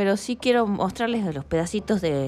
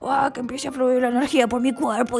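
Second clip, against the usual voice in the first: higher, hammed-up hype chant; mock the jumping pre-show crowd; a mock psyched-up warm-up cry; mock pumped-up performer; 5 semitones higher than usual. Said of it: "hay gente que se pone como a saltar y dice".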